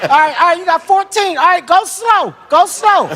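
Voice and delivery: high, tense pleading; high-voiced, coaxing the dealer; nervous pleading.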